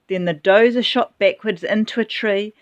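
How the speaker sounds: ordinary delivery, nothing marked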